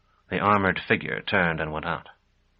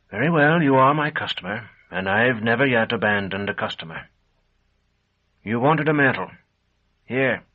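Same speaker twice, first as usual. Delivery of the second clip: higher, louder resigned merchant; lightly voiced shopkeeper relenting; 3 semitones higher, 4 dB louder than usual. A narrator's reading. Shopkeeper giving in to his customer, higher and louder. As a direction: give in to the customer, raised.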